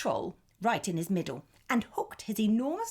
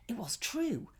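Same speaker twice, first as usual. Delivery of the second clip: ordinary delivery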